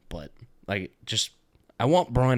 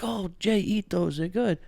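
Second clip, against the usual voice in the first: imitating mom; 11 semitones higher, slower than usual. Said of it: "my mom was like".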